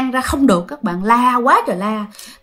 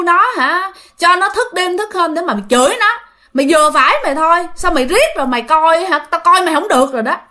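acted angry mother scolding her son, higher; indignant telling-off with a raised pitch; tức giận; 6 semitones higher than usual. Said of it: "Bắt đầu bà nội mới tức". (giọng bà mẹ tức giận mắng con)

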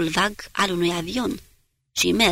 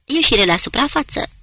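higher, louder command, rising on ieșire; ordering; 6 semitones higher, 5 dB louder than usual. Acted. Captain orders the submarine to surface, loud command. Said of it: "și ordonă".

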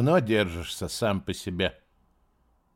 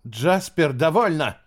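sharp, louder cry with pleading; 6 semitones higher, 5 dB louder than usual. Acted. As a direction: snap it but beg underneath. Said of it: "Резко, но с ноткой мольбы".

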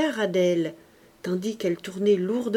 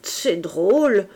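slow, slurred, mouth full of saliva; drunken slur; 6 dB louder, slower than usual. A narrator's reading. Drunk uncle dodging the money, slow slurred voice. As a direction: mumble like a fake drunk. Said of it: "la bouche pleine de salive".

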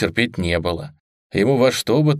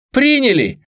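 loud, high victory cry; joyful shout; 6 dB louder than usual. Acted. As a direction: shout it with joy. Shout of joy.

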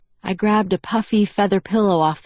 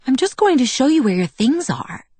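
higher, lilting innocent protest; feigned innocence; 8 semitones higher than usual. A narrator's reading. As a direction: say it innocently, pitched up. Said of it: "she said innocently".

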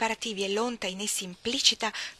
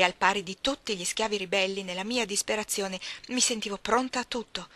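plain reading voice

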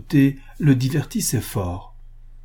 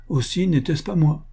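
curtly; 6 semitones higher than usual. A narrator's reading. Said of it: "dit sèchement Laréole".

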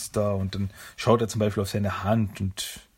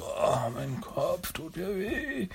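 high, slow, pained groan of a voice; a woozy turtle coming to with a sore head; groggy Mikey; 9 semitones higher, 6 dB quieter, much slower than usual. Retold or imitated.

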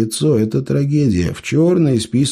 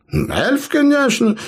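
innocent; slower than usual. A narrator's reading. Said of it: "невинно ответил гном".

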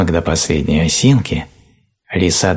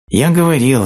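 sigh; 10 semitones higher, 4 dB louder than usual. A narrator's reading. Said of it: "вздохнул ослик".

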